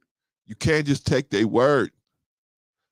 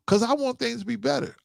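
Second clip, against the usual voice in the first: a higher imitated girlfriend, lightly put on; girlfriend's voice; 10 semitones higher than usual. Him mimicking his girlfriend wanting things better, higher. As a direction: her pleading line, mimicked up high.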